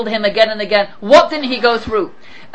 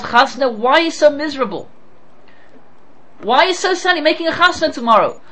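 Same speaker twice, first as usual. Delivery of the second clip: higher-pitched, animated questioning; acting out an incredulous questioner; puzzled challenge; 5 semitones higher than usual. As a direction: question him insistently, voice lifted. Acted challenge to Rabbi Gamliel.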